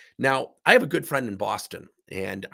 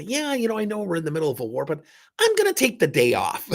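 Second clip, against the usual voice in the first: mock casual shirker; 5 semitones higher than usual. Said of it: "John couldn't say".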